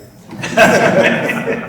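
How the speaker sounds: a burst of breathy laughter, high and loud; a quick real laugh. Real, not acted. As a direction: laugh out at the fun of it. Laughs at her own life story.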